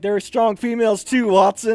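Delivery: barked rebuke, acted out; drill sergeant's shout. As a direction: call a trainee out like a drill sergeant.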